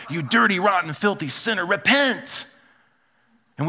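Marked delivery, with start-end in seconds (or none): 0.1-2.5 hammed-up hellfire preacher, higher and louder. Preacher mocking condemning sermons at a sinner, hammed-up. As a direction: rant it at the sinner like a brimstone preacher. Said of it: "I can't even do that voice".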